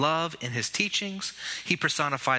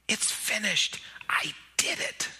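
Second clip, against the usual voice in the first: breathless whispered relief, voice almost gone; new mother whispering over her baby; exhausted whisper; 3 semitones higher than usual. Shown as a demonstration. Mother just gave birth.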